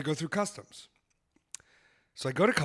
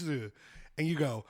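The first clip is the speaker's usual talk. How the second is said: usual speaking voice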